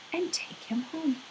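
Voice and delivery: soft whisper, almost no voice; whispered ending. A narrator's reading. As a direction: breathe the words out like a secret. Gentle close of story line.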